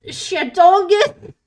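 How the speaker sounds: slow, loud, drawn-out new name; silly proud announcement. Real, not acted. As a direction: announce the goofy name with glee.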